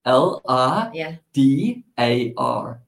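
"L I D A R" spelled letter by letter, very slowly. Shown as a demonstration. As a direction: spell "L I D A R" out deliberately, each letter apart. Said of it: "Spell it".

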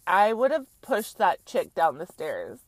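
normal talking voice

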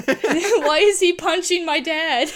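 high, loud, slow laughing outcry; laughing mock dismay. Joking as a moviegoer upset at the hero, laughing. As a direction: whine it while cracking up. Joking imagined audience reaction.

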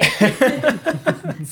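high burst of laughter. Real, not acted.

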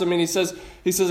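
usual speaking voice

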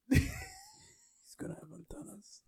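squeaky high whisper, slow. Retold or imitated. Whispered Lucas pitch, high.

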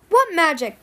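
high, loud, baffled protest. A narrator's reading. Captive denies having magic, protesting. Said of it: "Pirate protested".

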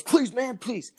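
high-pitched mock begging; exaggerated high plea; pleading beggar. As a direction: imitate a desperate panhandler, voice pushed up high. Imitates beggar pleading for change.

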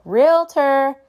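high, loud call-out; demonstrated shout. Shown as a demonstration. Realtor warning whoever is in a vacant home, shouting. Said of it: "you'll hear me shout".

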